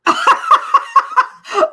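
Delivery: a high, loud burst of laughter; big laugh. Real, not acted. Laughing out loud at Tammy's chat comment, big laugh. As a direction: crack up at a friend's comment.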